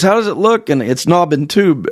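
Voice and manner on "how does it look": higher voice as dad calls down the crawl space; dad checking on his kid below; dad calling down. Retold or imitated. Father calls down to son under the floor, higher voice. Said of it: "and he goes".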